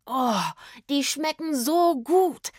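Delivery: high, slow, savouring groan; blissful moan. A narrator's reading. Rufus tastes cherries up in the tree, blissful moan. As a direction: moan with pleasure, stretching the words. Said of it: "stöhnte er genüsslich".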